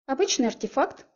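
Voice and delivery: выше, испуганный писк; пискнуть от страха; писк ангела. A narrator's reading. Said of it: "пискнул от костра ангел". (ангел у костра испуганно и высоко пищит)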